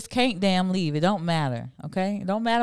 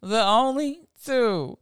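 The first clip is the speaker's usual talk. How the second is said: higher-pitched, words through laughter; laughing; 11 semitones higher than usual. Real, not acted. Host cracking up at own dare question, laughing. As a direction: laugh hard, then drag out the words.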